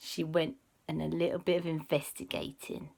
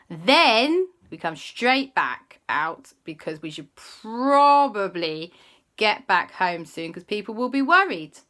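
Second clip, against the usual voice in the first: put-on bossy sensible kids; 8 dB louder, much slower than usual. Kids take charge sensibly.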